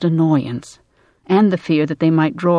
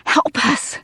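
high, strained gasp; 10 semitones higher than usual. A narrator's reading. Woman lifting a body over a fence, gasping for help. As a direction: gasp it out under a heavy strain. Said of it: "Cynthia gasped".

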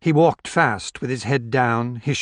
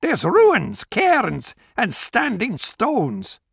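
high-pitched gamekeeper; gamekeeper's character voice; 9 semitones higher, much slower than usual. Acted. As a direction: voice the gamekeeper high above the narration. Gamekeeper figure speaking in the story.

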